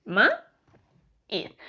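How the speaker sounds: maíz muy partido: "ma", pausa larga, "íz"; separado en sílabas. Shown as a demonstration. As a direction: di maíz como dos sílabas sueltas, ma-íz. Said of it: "decimos maíz". (maíz silabeado, con la í aparte)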